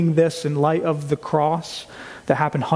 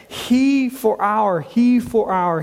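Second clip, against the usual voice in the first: "He" stressed high against "our", slow; he versus our; 4 semitones higher, much slower than usual. Shown as a demonstration. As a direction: punch "he" and set it against "our". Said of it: "contrasts with the ours here".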